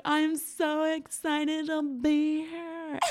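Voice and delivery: high, slow gushing "I'm so excited to be here"; naive gushing. Retold or imitated. Telling Spanish club guys she is happy, high slow gushing. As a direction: gush like an innocent tourist. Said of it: "and I was like".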